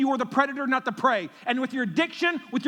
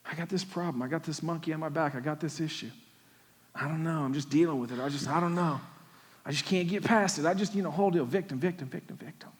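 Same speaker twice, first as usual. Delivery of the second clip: a soft, mopey, lower voice of self-pity; the victim's whine trailing off to a whisper; mock self-pitying victim; 9 semitones lower, 5 dB quieter than usual. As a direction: sulk it out like someone stuck as a victim. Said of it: "you feel like a victim".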